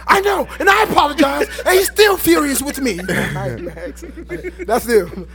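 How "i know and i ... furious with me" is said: playing the sheepish frat guy; frat bro. Voicing Max's reply.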